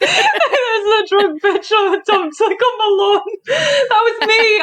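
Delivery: high laughing confession. Real, not acted. Laughing as she admits it to her co-host. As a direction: laugh it out, slowly.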